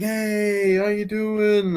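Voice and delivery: high, slow, sing-song greeting; smarmy greeting. Retold or imitated. Man mock-greeting the world as his day, high and slow. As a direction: stretch it out like a slick charmer. Voicing a smarmy movie character.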